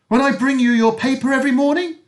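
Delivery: high, loud squeaky woman's voice. Acted. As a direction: keep the shrill squeak going. Same squeaky woman speaks again.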